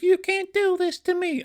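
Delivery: very high-pitched outraged cartoon villain; mock yell. King Dedede being hauled away by cops, hammed-up high yell. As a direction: wail it way up high, hammed-up yell without much volume. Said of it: "he's yelling".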